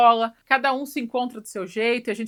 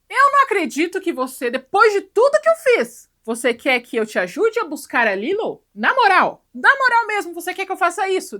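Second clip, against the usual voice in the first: much higher, much louder, indignant; hammed-up outraged shriek; outraged disbelief; 9 semitones higher, 10 dB louder than usual. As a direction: yell in shocked disbelief. Jumba outraged at Stitch's request.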